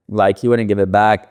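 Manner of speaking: ordinary talk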